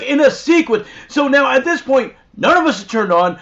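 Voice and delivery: ordinary talk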